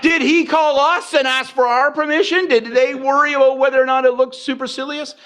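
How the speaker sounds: high, incredulous mock protest; acted indignation. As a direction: play comic outrage, pitch kept way up. Acted indignant questions after the note.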